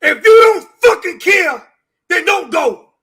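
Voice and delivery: tearful yell; crying shout. Real, not acted. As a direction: shout through real tears. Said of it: "so I'm trying my best not to cry".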